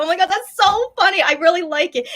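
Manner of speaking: replaying her own delighted reaction; excited gush. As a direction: burst out like you love the joke. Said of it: "the way I laughed and reacted to it".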